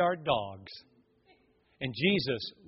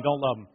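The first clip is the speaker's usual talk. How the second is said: "Don't" stressed at ordinary pitch, falling; dropped denial. Acted. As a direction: come straight down for "Don't love him". Peter's wavering acted as alternation.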